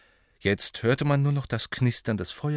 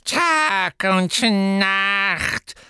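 very slow, higher croak; croaking elf; 10 semitones higher, 5 dB louder, much slower than usual. A narrator's reading. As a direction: rasp it out like an old elf. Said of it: "krächzte Creature".

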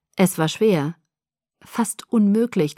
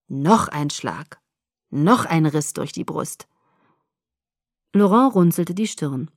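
ordinary delivery

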